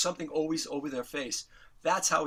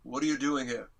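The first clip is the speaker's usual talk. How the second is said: said straight out, deadpan; blunt question to uninvited visitors; 3 semitones lower than usual. Retold or imitated. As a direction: imitate a star's matter-of-fact challenge. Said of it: "and straight out".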